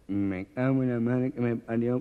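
slow, lightly nasal grumble; the nasal-voiced man waiting for the train. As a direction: mutter it through the nose, impatient. Gangoso character's voice.

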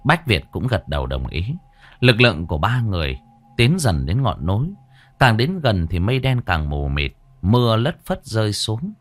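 usual speaking voice

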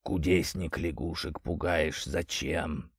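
low, soft bubbling voice; gurgling water spirit. Acted. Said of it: "пробулькал из прибрежных зарослей".

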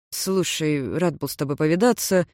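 ordinary delivery, nothing marked

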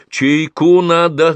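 high, loud, stretched call; bossy canteen holler. A narrator's reading. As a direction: call the order across the canteen. Canteen woman calls out for tea.

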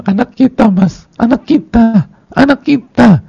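high, drawn-out tearful wail to a husband; hysterical mock wailing. Wife crying to her husband about their child, hammed-up wail. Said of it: "saya nangis depan dia".

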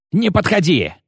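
high frantic yell. A narrator's reading. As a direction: scream a desperate threat. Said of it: "заорал он".

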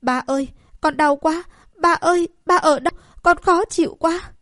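high, loud pained wail for her father; crying out in pain. Acted. Sick princess crying to her father in pain, high anguished wail. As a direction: sob it out like a child in agony. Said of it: "đau đớn kêu to".

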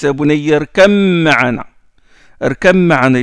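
irkam ma'ana with the b merged into a doubled m; demonstrated irkam ma'ana. Shown as a demonstration. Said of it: "Il a pas dit irkab".

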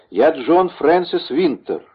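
high, slow announcement; proud declaration. A narrator's reading. False monk revealing himself to executioner, high slow declaration.

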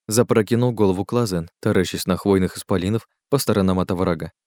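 plain reading voice

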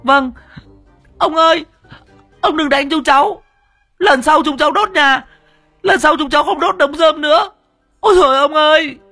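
put-on very high, loud crying child voices; wailing kids. A narrator's reading. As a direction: wail and beg while running away. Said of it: "vừa chạy vừa mếu máo". (trẻ con mếu máo van xin)

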